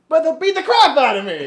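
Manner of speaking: high, loud mock-panicked protest; Tom Hanks yelp. Son explaining to his father why he needs money, hammed-up yelling. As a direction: imitate a movie star breaking into a yell. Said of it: "what do you think is the Hanksiest line".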